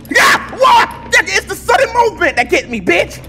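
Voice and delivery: very high indignant yelling; startled screaming. Real, not acted. Player hit by sudden movement, startled screaming. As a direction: shriek it at the thing that scared you.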